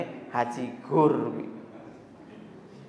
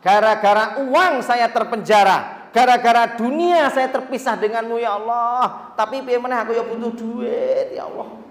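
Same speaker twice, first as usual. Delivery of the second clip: higher, louder pleading cry to God; tearful prayer; 6 semitones higher, 6 dB louder than usual. Retold or imitated. Worker crying to God at work, higher, louder pleading. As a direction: plead as if half in tears. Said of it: "Kerja ki saya karo nangis".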